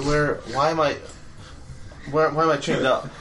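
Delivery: usual speaking voice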